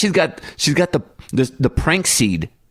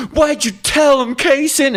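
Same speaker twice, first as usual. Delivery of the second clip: put-on a very high, louder, slow wail of a betrayed child; upset little girl; 3 dB louder, slower than usual. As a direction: wail at your brother like a hurt kid. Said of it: "She goes".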